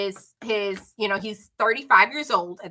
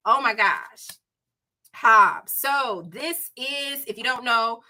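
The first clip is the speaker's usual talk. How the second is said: ordinary talk, nothing marked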